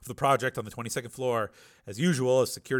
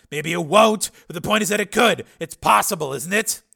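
raised, higher, pressing argument; Steele, agitated; 8 semitones higher, 11 dB louder than usual. A narrator's reading. Patient imagines his mind in another body, loud and agitated. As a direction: build to a loud indignant question.

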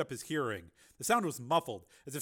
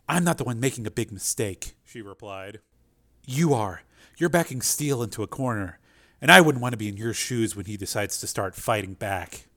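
Linda voice; 2 semitones lower, 11 dB louder than usual. Acted. Linda cool reply.

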